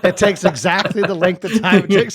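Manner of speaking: talking through laughter; laughing. Real, not acted. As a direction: laugh while saying it. GM jokes while laughing.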